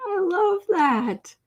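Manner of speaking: slow high delighted coo. Real, not acted. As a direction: savour the delight. Delighted at kitty's name.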